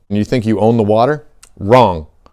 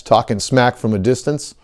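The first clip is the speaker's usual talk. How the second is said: normal talking voice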